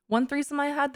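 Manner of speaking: ordinary delivery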